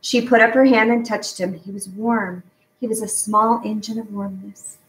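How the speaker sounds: ordinary delivery, nothing marked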